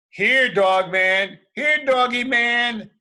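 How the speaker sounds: slow loud call for a dog. Acted. Cops searching the city, calling for Dogman. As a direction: call the lost dog home.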